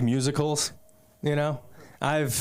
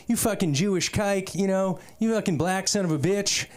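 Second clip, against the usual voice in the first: higher-pitched imitation of a heckling opponent; mock taunting; 7 semitones higher than usual. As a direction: hurl it like a mock trash-talker. Imitates opposing hillbilly players' insults.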